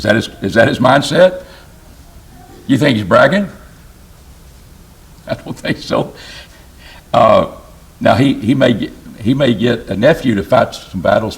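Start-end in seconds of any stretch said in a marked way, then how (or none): 5.3-6.1 soft aside. Real, not acted. Quiet aside after rhetorical question.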